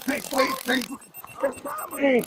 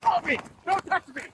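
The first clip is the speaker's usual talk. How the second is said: panicked plea; 6 semitones higher, 3 dB quieter than usual. Real, not acted. Man resists arrest, tased.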